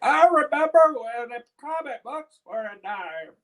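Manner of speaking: put-on high, loud old man voice. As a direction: reminisce like a creaky grandpa. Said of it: "That's my old man impression".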